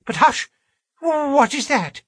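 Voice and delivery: high, frightened hushing; startled alarm. A narrator's reading. Man hears a sound from the house, high startled alarm. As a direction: jump in pitch, alarmed by a sudden noise.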